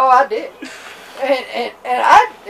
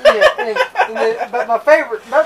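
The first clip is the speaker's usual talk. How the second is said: normal talking voice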